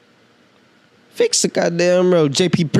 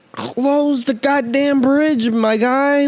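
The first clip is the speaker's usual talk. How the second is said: slow, much higher, exasperated; real fed-up rant; heated rant; 10 semitones higher, slower than usual. Real, not acted. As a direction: drag it out in disbelief. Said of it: "that was a little freaking rant".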